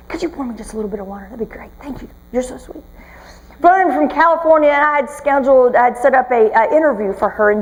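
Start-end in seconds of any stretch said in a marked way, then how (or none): 0.1-2.8 softly asking for water off mic; murmured side request, much softer; quiet aside. Real, not acted. Speaker quietly asks a helper for water, soft aside.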